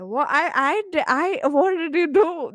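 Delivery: loud, high-pitched mock disbelief; stunned reaction. Coach retelling her reaction to a client's win, loudly astonished. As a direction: act out being floored, stumbling over the start.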